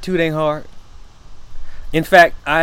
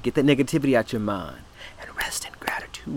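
hushed; 4 semitones lower, 9 dB quieter, faster than usual. Real, not acted. Preacher drops to intimate hush.

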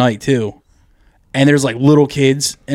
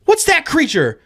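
put-on much higher, curious child asking; wide-eyed kid question; little kid voice; 12 semitones higher than usual. Kid asking his mom about the screen, childish. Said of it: "He's like".

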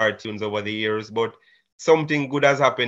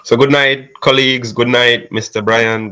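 loud greeting; 8 dB louder than usual. Real, not acted. Student loudly greets the class.

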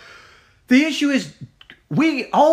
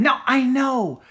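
ordinary talk, nothing marked

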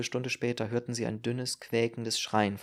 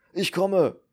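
raised, high answering shout; calling back; 7 semitones higher, 8 dB louder than usual. Acted. Braun answering the doctor's call, loud and high. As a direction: shout back across rooms in haste.

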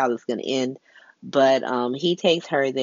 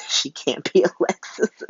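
ordinary talk

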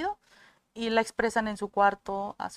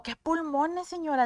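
admiring exclamation; 6 semitones higher, 4 dB louder than usual. Acted. Acts out admiring remark to new mother.

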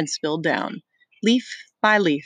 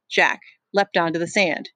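ordinary delivery

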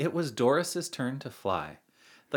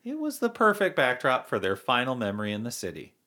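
plain reading voice

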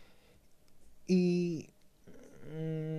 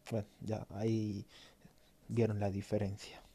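ordinary delivery

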